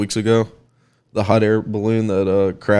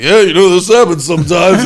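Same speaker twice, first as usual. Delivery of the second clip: breezy pilot excuse; 7 semitones higher, 6 dB louder than usual. Retold or imitated. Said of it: "He's like".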